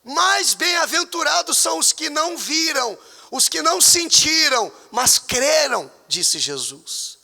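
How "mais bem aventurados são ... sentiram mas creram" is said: loud high proclamation; fervent preacher's cry; 1 preaching loud. Acted. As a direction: proclaim the verse with force. Preaching a Bible quote.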